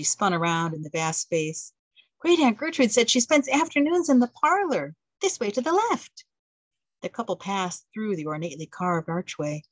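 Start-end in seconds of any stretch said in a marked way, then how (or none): 2.2-6.2 put-on eager young wife. April, eager guide.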